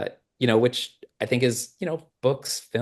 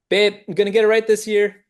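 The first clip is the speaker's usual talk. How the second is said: higher, louder, cocksure promise; so confident; 11 semitones higher, 5 dB louder than usual. Retold or imitated. Husband assuring his wife about her birthday, confidently. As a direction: sell it like you're sure you finally got it right. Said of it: "Like, so confident".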